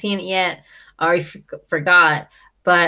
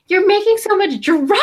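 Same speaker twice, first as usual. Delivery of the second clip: high, loud mock shriek; hammed-up imitation of an outburst; Snooki freak-out; 13 semitones higher, 5 dB louder than usual. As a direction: wail it melodramatically, building to a shout on the last word. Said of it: "Snooki's just like".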